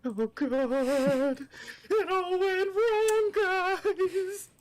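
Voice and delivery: dismayed wail. Real, not acted. Player dismayed at failure.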